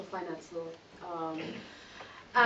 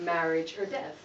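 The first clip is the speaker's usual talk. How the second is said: ordinary talk, nothing marked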